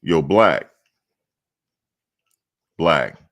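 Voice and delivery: loud call across the room; calling out to check the caller is still there. Real, not acted. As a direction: shout a name to get his attention.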